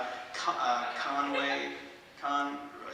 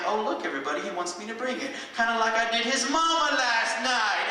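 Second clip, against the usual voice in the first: mocking comeback; 7 semitones higher than usual. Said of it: "And I was like".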